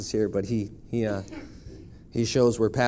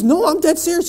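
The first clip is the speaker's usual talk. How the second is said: excited; 8 dB louder than usual. Retold or imitated. Retold reply in Iraq.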